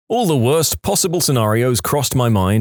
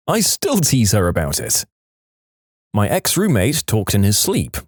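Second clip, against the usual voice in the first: ordinary delivery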